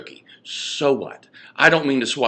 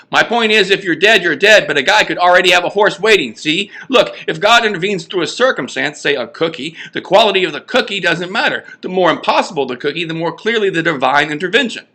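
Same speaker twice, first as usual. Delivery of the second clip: high, pressing, a bit louder; worked-up pleading; 6 semitones higher, 4 dB louder than usual. Acted. Parrish urging his friends to see the point, high and pressing. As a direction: push the point, almost begging.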